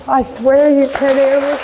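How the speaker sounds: desperate mother shouting encouragement, slowed; loud plea. Real, not acted. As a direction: cry out I swear, loud and slow. Mother pleading with addicted daughter.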